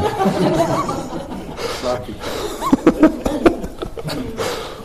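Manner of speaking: high breathy laughter; a long amused cackle. Real, not acted. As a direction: laugh hard at the joke. Preacher laughs at own joke.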